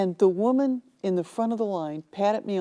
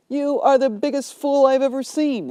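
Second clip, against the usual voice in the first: higher, louder mock outrage; angry insult; 6 semitones higher, 5 dB louder than usual. Rude customer shouting at the bookstore clerk, angry.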